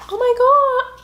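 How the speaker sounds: high, loud gasp of delight; real overjoyed amazement; amazed delight. Real, not acted. Sees the package contents, amazed.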